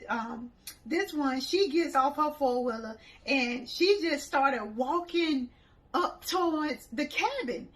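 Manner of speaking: ordinary talk, nothing marked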